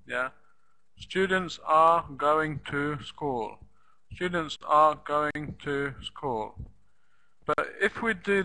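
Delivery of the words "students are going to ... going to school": word by word like a list, full "are" and "to"; every word stressed. Shown as a demonstration. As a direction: give Students, are, going, to, school equal weight. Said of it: "every word as if it's in the list".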